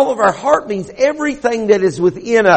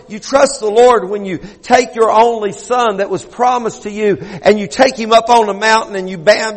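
ordinary delivery

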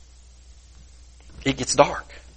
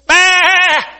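very high, loud, long baa; loudest bleat; 13 semitones higher, 6 dB louder than usual. Acted. Stranded sheep calling for help, bleating loudly. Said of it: "he baas as loud as he can".

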